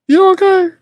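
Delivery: very high, loud squeak of concern; mock concern. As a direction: squeal the check-in with mock worry. Mock concern for puzzling chatter.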